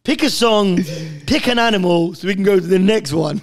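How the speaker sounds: loud, high-pitched, cracking up; laughing exasperation. Real, not acted. Hurrying mates to the next question, loud laughing voice. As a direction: urge them on through helpless laughter. Laughing complaint about the game.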